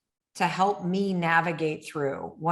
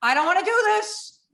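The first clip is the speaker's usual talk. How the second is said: high, loud pleading panic; mock panic at the plane door; panicked plea; 9 semitones higher, 5 dB louder than usual. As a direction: beg not to be let out. Retelling panic before jumping.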